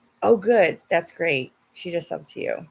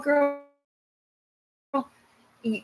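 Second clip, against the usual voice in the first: ordinary talk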